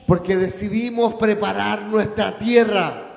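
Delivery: proclamación fuerte al público; repítelo con fuerza, predicando; prédica enérgica. Real, not acted. Repite la frase arrancando la exhortación. (proclamando en voz alta)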